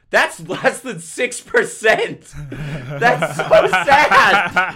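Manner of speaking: high, loud, drawn-out laughing wail; mock outrage. Real, not acted. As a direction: cry out at a humiliating score, cracking up. Reads own humiliating last-place result.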